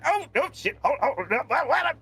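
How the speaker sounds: high, panicked tinkerer losing his footing; falling character. Acted. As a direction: yelp in bursts as you tumble. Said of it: "As you then hear".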